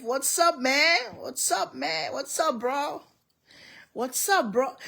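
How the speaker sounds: a hint of a hip young guy, lightly voiced; mock casual greeting. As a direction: toss off the greeting, breezy and cool. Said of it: "Miss Vicky said".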